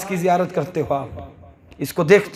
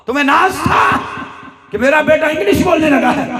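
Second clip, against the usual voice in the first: fiery sermon shout; 8 semitones higher than usual. Acted. Preacher rebuking proud parents, full-force shout. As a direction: build to a ringing shout at the congregation.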